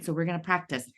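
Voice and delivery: normal talking voice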